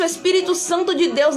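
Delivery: normal talking voice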